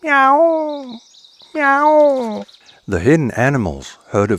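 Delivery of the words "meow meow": high, drawn-out meow; cat meowing. Acted. Cat calling out at the meat. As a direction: sing out a cat's meow. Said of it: "he meowed".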